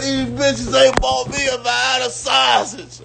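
mock whiny shout. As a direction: shout it like the drunk friend. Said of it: "He said".

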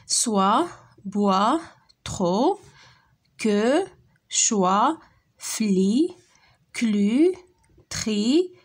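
each syllable said alone, slow and clear; slow syllable reading. Shown as a demonstration. Teacher reading syllables slowly to pupils, one by one. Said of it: "Lis les syllabes".

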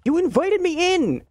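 high, loud cry of disbelief; indignant outburst. Real, not acted. Yelling back at the bratty kid, high and loud in disbelief. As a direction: throw it back at him, outraged.

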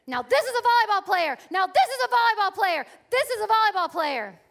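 loud, high shouted praise from the coach; coach shouting praise. Retold or imitated. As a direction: yell it like a proud coach courtside. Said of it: "she starts to say".

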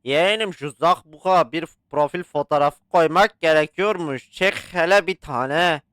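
normal talking voice

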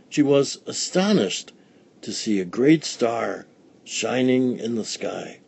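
usual speaking voice

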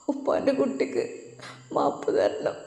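a daughter half-crying as she begs forgiveness; tearful plea. Acted. Daughter begging her father's pardon, softly tearful. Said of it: "അവൾ കരഞ്ഞുകൊണ്ട് ഉപ്പയോട് പറഞ്ഞപ്പോൾ".

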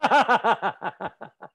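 real laugh. Real, not acted. Teacher cracks up at the monkey story, a real laugh.

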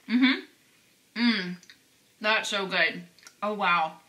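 chewing while murmuring; talking through a bite; mouth full. Real, not acted. Seen 2: bites at -1.0; closed mouth, jaw working, cheeks bulging throughout.

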